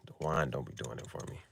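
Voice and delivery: half-murmured to himself; very quiet. Real, not acted. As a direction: drop almost to a murmur. Repeats, near whisper.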